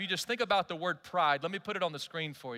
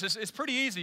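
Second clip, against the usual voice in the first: normal talking voice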